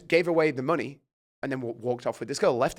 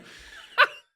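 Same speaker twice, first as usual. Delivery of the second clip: airy laugh; 7 dB louder than usual. Real, not acted. Laughs at own story.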